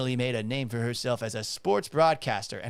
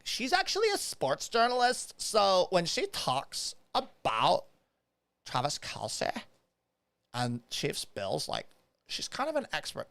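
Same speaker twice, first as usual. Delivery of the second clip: mocking imitation; 3 semitones higher, 5 dB quieter, slower than usual. Quoting commenters who defend her, mocking. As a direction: parody a know-it-all, dragging out the words.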